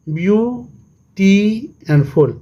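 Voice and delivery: very slow, stretched "beauty and full"; long gaps splitting beau, ty and full; syllable by syllable. Shown as a demonstration. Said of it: "तो ये कितना हो गया". ("beauty and full" बहुत धीरे, तोड़-तोड़कर)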